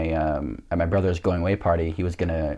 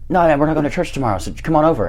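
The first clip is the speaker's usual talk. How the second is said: quick, higher brush-off; imitated friend; 8 semitones higher, 4 dB louder, faster than usual. Friend coaxing him to sleep over, imitated brush-off. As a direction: reassure him fast and casually, pitched up.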